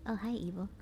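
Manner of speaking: ordinary talk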